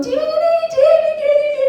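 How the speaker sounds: high-pitched squealed calls; thin, high holler for help; hollering for husband. Retold or imitated. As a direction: call the name over and over, very high. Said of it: "he can't hear me screaming".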